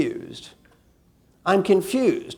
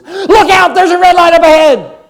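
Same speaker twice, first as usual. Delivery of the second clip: high-pitched, very loud panicked yell; alarmed passenger shout; 9 semitones higher, 13 dB louder than usual. Acted. Passenger warns the driver of a red light, alarmed shout. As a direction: shout a sudden high warning from the passenger seat. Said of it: "That person in the front seat goes".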